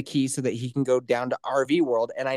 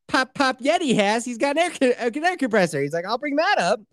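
excited; 8 semitones higher than usual. Retold or imitated. Voicing his dad excitedly.

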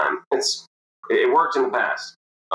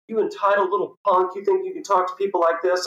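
imitated indignant scolding; 9 semitones higher than usual. Big man scolds driver in quarrel.

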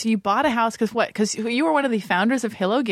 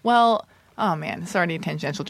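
ordinary delivery

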